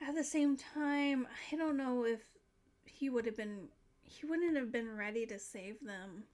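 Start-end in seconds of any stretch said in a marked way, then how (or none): none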